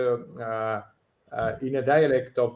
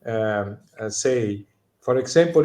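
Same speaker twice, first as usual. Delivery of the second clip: ordinary talk, nothing marked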